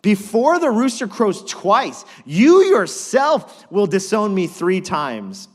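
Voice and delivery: loud, slow, high prophecy; Jesus warning Peter, loud and slow; solemn warning. Acted. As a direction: hammer each word of the warning. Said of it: "Truly I tell you Jesus answered".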